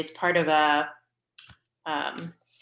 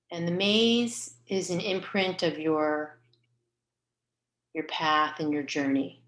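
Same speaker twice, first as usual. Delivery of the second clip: guided meditation. Real, not acted. Leader guiding listeners to picture their soul's path, calm guided meditation. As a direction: guide them gently inward, leaving space between phrases.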